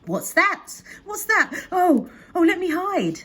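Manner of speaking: high, loud alarmed tourists; panicked cry. A narrator's reading. Tourists crying out at the sight of Nessie, panicked. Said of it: "all the tourists cried".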